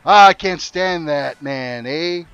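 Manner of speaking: hammed-up Canadian gripe, stretched and loud; mock Canadian complaint. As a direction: lean on the Canadian "eh" with a long "Ah". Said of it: "it works just about every situation".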